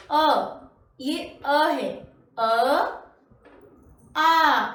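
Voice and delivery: अ short and open, then आ long and loud; clear demonstration. Shown as a demonstration. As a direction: sound out अ and आ for pupils to repeat. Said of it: "ठीक वैसा ही आप पीछे पीछे".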